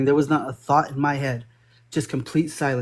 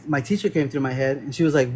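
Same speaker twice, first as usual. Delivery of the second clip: normal talking voice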